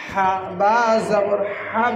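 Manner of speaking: হা, বা জবর হাব spelled slowly, each part drawn out; teacher slowly spelling হা, বা জবর হাব aloud; slow spelled recitation. Shown as a demonstration.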